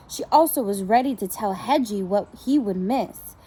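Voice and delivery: ordinary delivery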